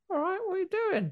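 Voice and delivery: put-on very high child voice; mock little boy. Homer greeting Caleb's sister, high child voice. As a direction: squeak it like a small boy. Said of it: "the little boy goes".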